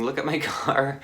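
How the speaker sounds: laughing through a mild mock scream; retold scream. Quoting Kristen upset about her car, mock scream with a laugh. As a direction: imitate a friend's scream lightly, breaking into a laugh. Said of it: "just screaming".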